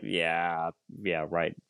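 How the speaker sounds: sarcastic. Retold or imitated. Sarcastic reply retold.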